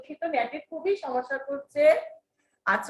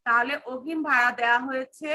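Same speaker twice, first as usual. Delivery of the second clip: ordinary talk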